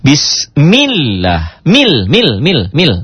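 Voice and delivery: "mil, mil" stamped high and slow in drill. Shown as a demonstration. Teacher drilling the stamped L for students, very slow. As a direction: hammer "Bismillah, mil, mil" syllable by syllable. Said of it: "hentakan ini hanya dalam latihan".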